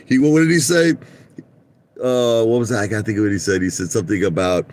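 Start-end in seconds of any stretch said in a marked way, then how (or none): none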